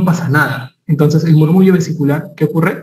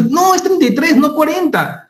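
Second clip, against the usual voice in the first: imitación aguda de un alumno terco; alumno gritando; 12 semitones higher than usual. Retold or imitated. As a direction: imita al alumno que grita, muy agudo. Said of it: "un alumno le gritó a otro". (alumno que le grita a otro, en voz aguda)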